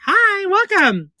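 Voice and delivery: high, slow, drawn-out hello; cooed high greeting to a new viewer; singsong greeting. Real, not acted. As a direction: sing the welcome up high.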